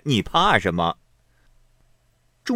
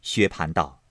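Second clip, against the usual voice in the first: ordinary delivery, nothing marked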